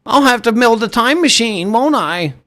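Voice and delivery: lightly voiced whiny rich kid; mocking wannabe.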